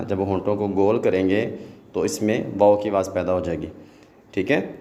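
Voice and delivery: ordinary talk, nothing marked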